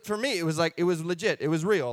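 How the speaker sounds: ordinary delivery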